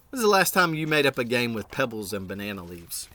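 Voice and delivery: ordinary delivery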